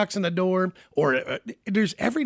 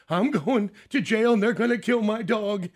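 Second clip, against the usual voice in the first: imitating crying kid; 6 semitones higher than usual. Eight-year-old neighbor boy at the door, mild mock sobbing. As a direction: play the panicked little boy, lightly. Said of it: "crying his eyes out".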